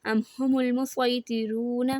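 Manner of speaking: slow, heavy ص in المسویطرون; recited with saad. Shown as a demonstration. Teacher recites the word reading it with ص. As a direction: say المسویطرون slowly with a heavy ص sound. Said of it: "میں صاد پڑھتی ہوں".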